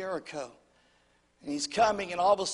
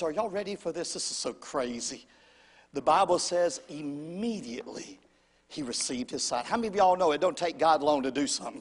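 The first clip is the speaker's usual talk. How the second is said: ordinary talk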